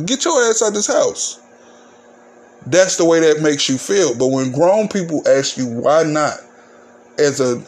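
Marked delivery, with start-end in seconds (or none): 0.1-1.4 much higher, ordering mom; bossy mother. Retold or imitated. Mother ordering her kid outside to play, bossy. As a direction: shoo the kid out the door.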